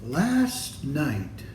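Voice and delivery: ordinary delivery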